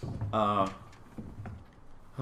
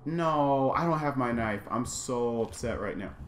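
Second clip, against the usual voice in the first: usual speaking voice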